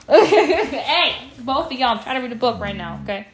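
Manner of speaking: calling out to two pets interrupting reading; laughing shout. Real, not acted. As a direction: shoo them off while laughing.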